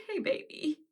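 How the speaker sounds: soft, high tender greeting; tender to son. Retold or imitated. Seeing her son again, soft tender hey baby. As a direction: greet a frightened child gently. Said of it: "I said".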